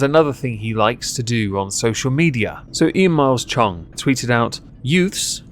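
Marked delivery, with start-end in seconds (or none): 4.8-5.4 youths said higher, as if in scare quotes; mocking quote marks in the voice; air-quoted youths. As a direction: put sarcastic air quotes round it. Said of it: "air quotes".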